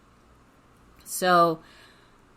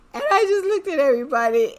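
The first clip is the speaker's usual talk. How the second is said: high, louder, rushed through laughter; choked with laughter; 9 semitones higher, 6 dB louder, faster than usual. Real, not acted. Storyteller recalling everybody laughing, high and breaking up. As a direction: squeeze the words out while cracking up.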